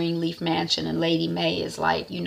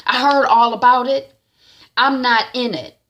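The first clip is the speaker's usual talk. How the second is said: loud, higher defiant refusal; angry refusal; 6 semitones higher, 7 dB louder than usual. Retold or imitated. Jacob refusing Triumph to Basie, loud and angry. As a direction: throw it back at him.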